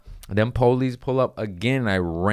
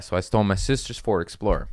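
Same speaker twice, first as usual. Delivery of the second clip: ordinary delivery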